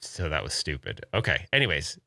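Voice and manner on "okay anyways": usual speaking voice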